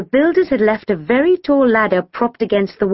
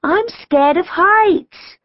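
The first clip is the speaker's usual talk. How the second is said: high, very slow frightened wail; 12 semitones higher, much slower than usual. A narrator's reading. Kim confesses fear from ladder top, frightened wail. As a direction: draw it out in fear. Scared girl stuck on ladder.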